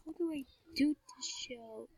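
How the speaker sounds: unvoiced whisper to self. Real, not acted. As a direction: whisper it to yourself.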